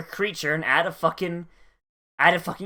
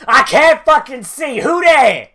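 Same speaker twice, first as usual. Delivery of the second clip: much higher and much louder, flailing blind; hammed-up cry of a blindfolded priestess; mock panicked priestess; 8 semitones higher, 10 dB louder than usual.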